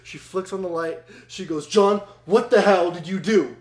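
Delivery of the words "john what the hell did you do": loud, raised-pitch accusing demand; mock-angry mom, loud and high; imitating furious mom. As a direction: demand an answer like an outraged mother. Mom confronts son at night.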